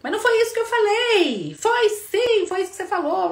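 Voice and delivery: two partners shouting back and forth; heated quarrel. Acted. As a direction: couple arguing over what was said, both yelling. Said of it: "eu falo A e o outro escuta B".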